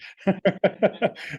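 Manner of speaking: short high laugh; real laugh. Real, not acted. Amused by his own mock recruit, laughing. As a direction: laugh at your own joke.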